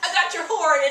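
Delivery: hammed-up smug taunt. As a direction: gloat with a little laugh, imitating a smug villain. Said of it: "smug, like".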